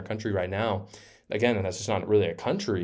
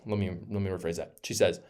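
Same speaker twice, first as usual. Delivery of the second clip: normal talking voice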